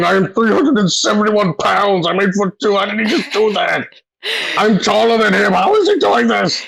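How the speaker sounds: high, disbelieving character voice; shocked, voice climbing up high; astonished. Acted.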